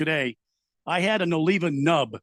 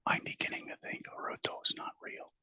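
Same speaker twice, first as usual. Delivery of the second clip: whisper; 15 dB quieter than usual. Real, not acted. Aside to co-host.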